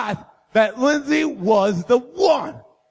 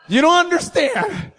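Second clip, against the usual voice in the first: nervous voice crack; 6 semitones higher than usual. Retold or imitated. Teen boy on the phone to Lindsay, voice cracking up high. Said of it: "my voice does that thing where I sound like I'm possessed".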